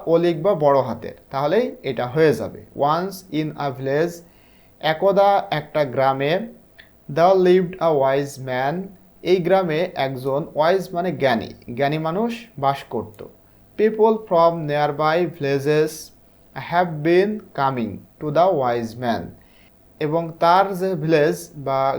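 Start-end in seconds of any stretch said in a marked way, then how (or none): 2.8-4.1 each word laid out very slowly; slow story reading. Shown as a demonstration. Teacher reading the story's first line to pupils, very slow. 7.1-8.8 each word laid out very slowly; slow story reading. Shown as a demonstration. Teacher reading the story's first line to pupils, very slow. 13.8-19.2 each word laid out very slowly; slow story reading. Shown as a demonstration. Teacher reading the story's first line to pupils, very slow.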